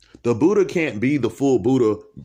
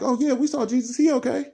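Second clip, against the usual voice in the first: high put-on gossipy voice, much higher; mocking onlookers; 13 semitones higher, faster than usual. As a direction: squeak it like chattering bystanders. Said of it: "They're like".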